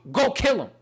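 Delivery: high, loud command from God; booming voice of God; divine command. Retold or imitated. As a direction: order it like God testing faith. God's command in Abraham story.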